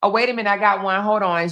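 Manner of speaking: ordinary delivery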